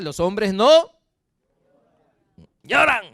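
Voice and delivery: lloran pushed out loud and high; mock the macho saying at full voice; mocking the old saying. Preacher ridiculing that men don't cry, loud mock. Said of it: "Pero como decía".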